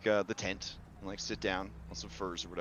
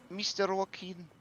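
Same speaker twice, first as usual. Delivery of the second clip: put-on high, earnest girl's voice offering help; polite young girl, much higher; timid young girl; 9 semitones higher than usual. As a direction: speak up as a shy girl eager to help.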